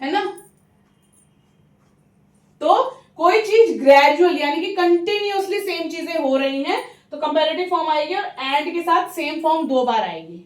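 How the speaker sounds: ordinary delivery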